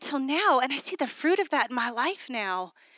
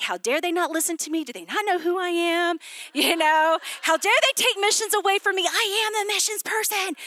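retold indignant rant; 6 semitones higher than usual. Woman mocking her past self's outrage over losing missions, indignant rising whine. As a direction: pitch climbing higher into an outraged whine. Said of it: "I was angry".

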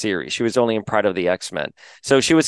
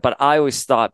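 ordinary talk, nothing marked

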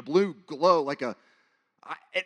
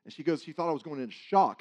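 ordinary talk

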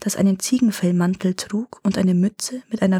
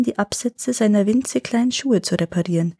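ordinary delivery

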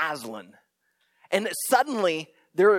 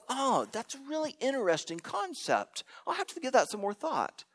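higher, softer, prissy polite musing; mock thoughtful listener; 8 semitones higher, 5 dB quieter than usual. Crowd member at the back, mock-musing politely. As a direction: play the detached armchair intellectual.

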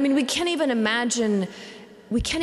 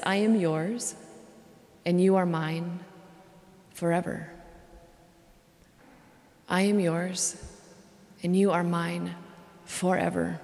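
tender vow; 3 semitones lower, 4 dB quieter than usual. Acted. Voicing God's covenant vow tenderly.

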